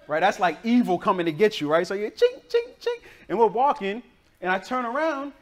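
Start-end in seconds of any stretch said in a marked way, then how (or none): 2.1-3.0 high, ringing "ching" repeated; short, high chings in even beats; imitated jingling sound. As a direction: mimic the dreaded jingle of a loose dog. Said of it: "y'all know that sound".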